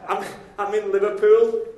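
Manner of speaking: mock-sheepish boy on the phone to his dad; lost boy on the phone. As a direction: blurt it out like a lost boy admitting where he is.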